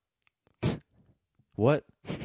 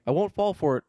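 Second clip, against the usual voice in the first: pleading; 6 semitones higher, 3 dB louder than usual. Pretend. Pleading not to go back.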